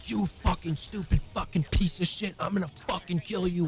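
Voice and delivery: high-pitched reenacted rage, no real shouting volume; enraged mock yell. Teen yelling at the kid he is beating, enraged mock yell. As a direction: act out a threat yelled mid-fight. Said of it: "I'm yelling at him".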